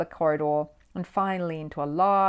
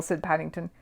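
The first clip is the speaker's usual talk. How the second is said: plain reading voice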